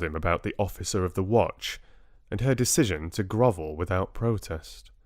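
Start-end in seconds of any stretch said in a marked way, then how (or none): none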